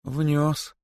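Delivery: small, high admission; crestfallen. A narrator's reading. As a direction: confess in defeat. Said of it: "сокрушённо кивнув головой". (сокрушённо)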